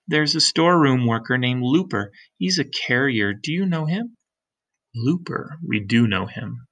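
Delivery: ordinary delivery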